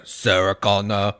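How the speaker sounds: a clumsy, low, slow Terminator Arnold; bad Arnold. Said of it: "trying to do a really bad, like, Arnold".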